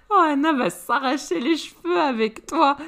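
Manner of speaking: parler en riant, plus aigu; rire amusé. Acted. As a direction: rire de bon cœur. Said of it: "S'amuse à Crystal". (Crystal rit d'Alistair)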